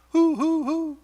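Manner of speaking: high imitated owl hooting; owl hoots. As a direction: hoot like an owl in an empty building. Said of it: "or, or an owl".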